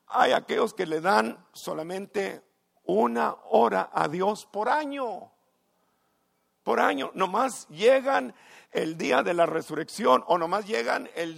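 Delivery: high, loud scandalized pulpit cry; outraged preaching. Acted. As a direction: shame them from the pulpit. Preaching at full force. (predicación indignada y aguda)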